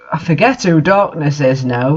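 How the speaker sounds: normal talking voice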